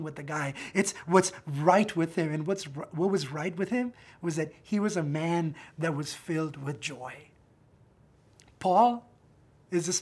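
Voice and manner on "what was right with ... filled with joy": normal talking voice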